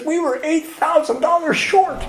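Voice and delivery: very high, slow, astonished disclosure; acted amazed reveal. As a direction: draw out the big reveal in wonder. Voices Larry revealing the coincidence.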